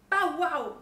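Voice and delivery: high, loud bow-wow; dog's bark. A narrator's reading. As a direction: bark it out for the children. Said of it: "said the dog".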